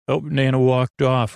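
ordinary talk

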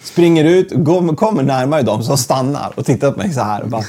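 ordinary delivery

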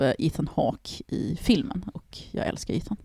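whispered aside, unvoiced and soft; confiding whisper. Real, not acted. Telling her co-host she loves Ethan, whispered aside. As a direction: let it slip out as a secret.

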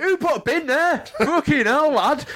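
put-on high, laughing old man rant; outraged old man. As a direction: squawk it like a fuming granddad. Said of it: "I'm like".

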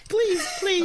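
high, eager begging through laughter; laughing plea. Real, not acted. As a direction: beg while cracking up. Laughing plea to see picture.

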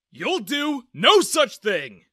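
loud, slow, high-pitched paternal outburst; angry interruption. A narrator's reading. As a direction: cut in and forbid it forcefully. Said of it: "Dad suddenly interrupted me".

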